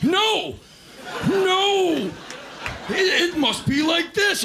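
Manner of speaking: laughing while he insists; mock insistent man. Man insisting to wife it must be so, laughing. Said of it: "And they're like".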